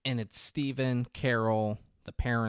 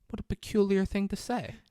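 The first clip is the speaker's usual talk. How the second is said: higher-pitched woman's line in a retold scene; lightly voiced woman, pitched up; woman's reply; 10 semitones higher than usual. Retelling her reply to him in the film, higher voice.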